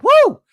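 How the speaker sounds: sudden loud, high "woo"; excited whoop. Real, not acted. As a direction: let out a big celebratory whoop. Host whoops at fiery ending.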